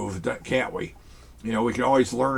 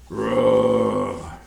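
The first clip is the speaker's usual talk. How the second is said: dragon roar; 4 semitones lower than usual. Pretend. Dragon toy roars again.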